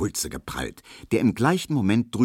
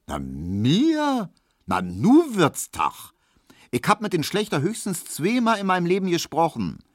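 höhere Stimme, empört abwehrend; tu beleidigt unschuldig; empörte Abwehr; 12 semitones higher, 3 dB louder than usual. A narrator's reading. Hedwig wehrt Verdacht ab. (Hedwig weist den Barbier entrüstet ab, höher)

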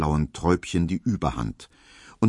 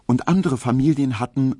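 ordinary delivery

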